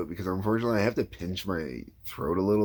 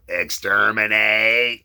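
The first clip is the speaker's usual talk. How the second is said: slow, louder Dalek exterminate; 2 semitones higher, 7 dB louder, much slower than usual. Shown as a demonstration. Demoing his Dalek warm-up line, slow and loud. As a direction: grind it out like a Dalek. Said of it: "first thing I always do is go".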